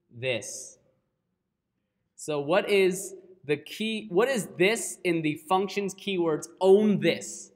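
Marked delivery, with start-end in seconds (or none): 2.1-7.5 a bit loud, pressing the question; energetic lecturer, a touch loud; slightly raised teaching voice. Real, not acted. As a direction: lean in and push the question out. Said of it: "Am I yelling?".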